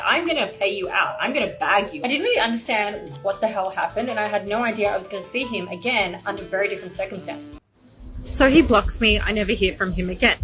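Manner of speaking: ordinary talk, nothing marked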